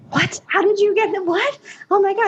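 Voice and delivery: high-pitched retold disbelief; retold astonishment. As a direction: relive the stunned reaction, voice jumping up high. Reacting to the life-changing moment.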